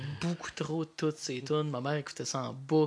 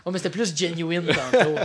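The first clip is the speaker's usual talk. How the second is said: laughing; 3 semitones higher, 7 dB louder than usual. Real, not acted. Teasing co-host while laughing.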